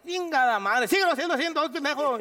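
high loud gruff boxer voice; Julio Chávez imitation. Julio telling the actor to keep it. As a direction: order him like Julio.

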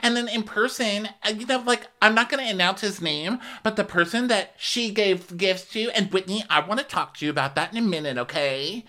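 high, sugary mock voices; mock Lisa and Jen voices. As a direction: voice the housewives high and sweet. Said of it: "Lisa's like".

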